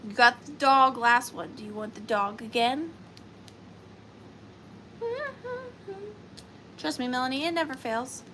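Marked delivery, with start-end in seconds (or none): 5.0-6.1 laugh. Real, not acted. Laughing at her own joke.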